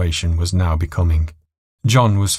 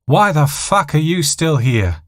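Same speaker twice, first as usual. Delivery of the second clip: roaring; 8 semitones higher than usual. A narrator's reading. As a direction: bark it at the stewardess. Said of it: "roared John".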